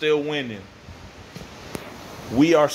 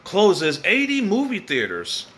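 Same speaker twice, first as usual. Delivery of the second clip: high, slow mocking voice; mocking critic; 8 semitones higher, slower than usual. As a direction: mimic the critics with scorn. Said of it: "You're saying".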